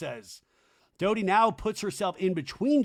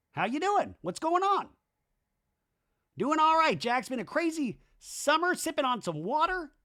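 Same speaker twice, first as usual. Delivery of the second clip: much higher aggrieved buddy voice; Danny impression; 11 semitones higher than usual. Danny confronting Jax about parties, high aggrieved voice. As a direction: whine it high like a hurt friend left out. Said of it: "Danny goes".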